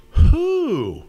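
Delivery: big, loud, high who; loud high hooting who. Acted. Bear's repeated question, louder.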